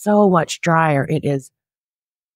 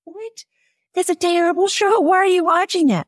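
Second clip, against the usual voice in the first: much higher, incredulous squeal at her mother; shocked disbelief. Retold or imitated. Daughter reacting to mom watching Breaking Bad, shocked high squeal. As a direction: squeak out the horror at her choice. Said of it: "And I was like".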